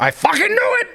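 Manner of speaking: very high comic shout; hammed-up, high-pitched yell of discovery; retold outburst. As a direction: squeal it in mock vindication. Retold outburst on seeing bedroom.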